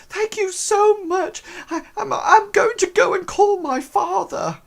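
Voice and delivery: high, gushing falsetto; excited girl. Acted. Erin thanks the orc for her gift, excited falsetto. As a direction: gush thanks in a squeaky girl's voice. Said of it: "And she's so excited".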